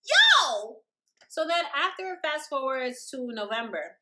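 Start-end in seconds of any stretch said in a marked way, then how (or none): 0.0-0.8 loud exclaim. Real, not acted.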